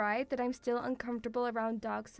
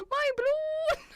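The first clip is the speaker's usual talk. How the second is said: high, loud mock cry; wailing kid; 6 dB louder than usual. Imagining a kid chased with balloon, loud mock wail. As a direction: belt it out like a distraught child, hammed-up. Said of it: "I'm just imagining".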